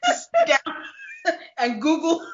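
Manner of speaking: cracking up. Real, not acted. Host joking about whose job finds these songs, cracking up. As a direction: struggle to get it out through laughter.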